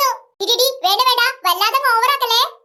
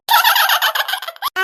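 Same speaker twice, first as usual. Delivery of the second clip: high giggle, trailing away; mocking laugh; 7 semitones higher than usual. Girl after teasing her sister, mocking giggle. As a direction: giggle at own jibe.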